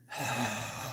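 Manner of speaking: long breathy sigh, acted out; weary sigh. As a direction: heave a mock exasperated sigh. Acted reaction to the police.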